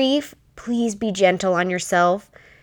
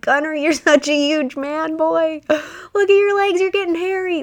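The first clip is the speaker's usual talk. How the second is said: much higher, sing-song teasing with a laugh; playful teasing; 9 semitones higher than usual. Retold or imitated. Mom teasing her crying teenage son, high playful voice. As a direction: tease him gently to lift his mood.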